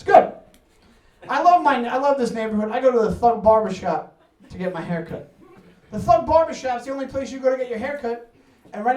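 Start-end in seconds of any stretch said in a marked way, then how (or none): none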